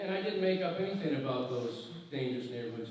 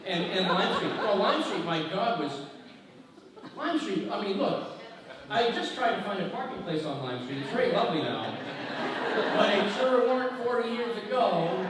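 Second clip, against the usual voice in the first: louder, higher, worked-up outburst about Lime Street; exasperated exclaiming; 4 semitones higher, 6 dB louder than usual. Real, not acted. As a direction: get fired up and incredulous about the street.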